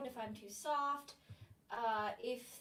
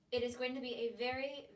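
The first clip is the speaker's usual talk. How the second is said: ordinary talk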